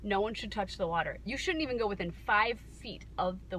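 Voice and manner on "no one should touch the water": normal talking voice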